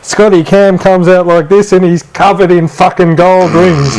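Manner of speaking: ordinary delivery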